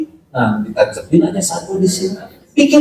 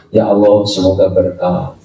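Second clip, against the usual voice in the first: sincere prayer; 8 semitones lower than usual. Retold or imitated. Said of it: "kita doakan". (doa tulus yang lembut)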